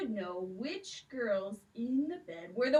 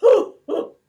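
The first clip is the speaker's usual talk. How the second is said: gloating giant chuckle; 9 semitones higher, 17 dB louder than usual. Acted.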